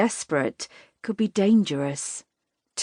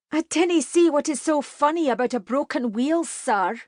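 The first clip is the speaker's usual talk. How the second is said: much higher, affronted Scots rebuke; indignant; 9 semitones higher than usual. A narrator's reading. Katie rebukes the laughing riders, high indignant. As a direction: put them in their place.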